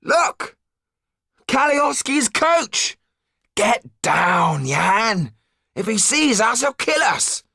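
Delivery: high, tense urging to get down; alarmed, pressing whisper-free warning; urgent warning. Acted.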